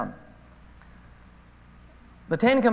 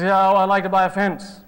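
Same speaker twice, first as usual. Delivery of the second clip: louder, deep-voiced farmer on the phone; a hint of a gruff farmer caller; 5 dB louder than usual. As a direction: caller wanting to buy a fence, in a lightly voiced farmer voice. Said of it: "Deep voices, farmers".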